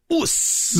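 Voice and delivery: breathy high "Us" for yes; Aussie "us". Retold or imitated. Bandit saying "Us" for nailed it, high and breathy. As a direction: toss off "Us" like a proud dad. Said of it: "he might go".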